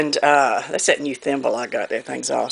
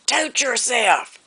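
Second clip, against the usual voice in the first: put-on child copying grandmother's high, drawn-out holler; copied holler; 8 semitones higher, much slower than usual. Child copying grandmother's call at the dog, mock hollering. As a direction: call it out high and slow, without real volume. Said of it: "and I said".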